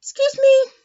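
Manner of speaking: high, loud mock embarrassment; squeaky embarrassed excuse me; startled apology. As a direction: gasp "oh" then apologize in a high drawn-out voice. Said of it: "He's like".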